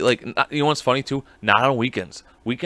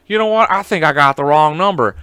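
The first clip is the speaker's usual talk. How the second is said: imitating caller hang-up; 7 dB louder than usual. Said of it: "he goes".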